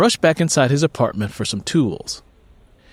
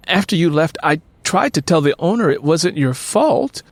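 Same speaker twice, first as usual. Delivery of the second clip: a touch higher and apologetic; lightly voiced Carmen, higher; 6 semitones higher than usual. A narrator's reading. Carmen apologizing to Luther, lightly higher voice. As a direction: Carmen pleads her side gently.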